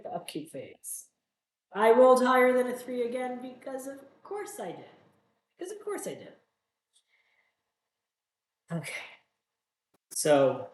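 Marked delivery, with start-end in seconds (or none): 1.8-4.8 loud, high outburst at bad luck; exasperated. Real, not acted. Another bad dice roll. 5.6-6.3 high but softer resigned echo; exasperated. Real, not acted. Repeats the complaint about luck.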